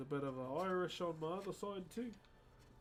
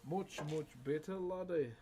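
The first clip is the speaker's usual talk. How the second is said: light Scottish dwarf brogue; a touch of Scottish accent, rolled and hearty. Pretend. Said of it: "I noticed you love doing a Scottish accent".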